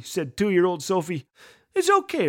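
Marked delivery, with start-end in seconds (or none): 1.7-2.3 put-on high, soothing reassurance to a toddler; reassuring. Mom calming her toddler after the mower stalls, soothing and high. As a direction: settle the child gently. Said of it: "Raylyn assured her".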